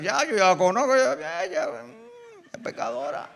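privately judging, half-swallowed words; a hint of a sanctimonious judge; lightly voiced religious hypocrite. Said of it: "¿qué ha dicho el religioso?".

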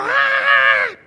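very high shrieked scream. Retold or imitated. Preacher imitating the woman shrieking during deliverance, high shriek. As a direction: let out a piercing high shriek. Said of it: "comenzó a gritar".

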